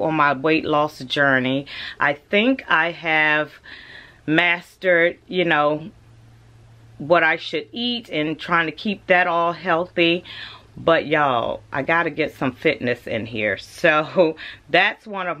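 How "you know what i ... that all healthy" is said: ordinary talk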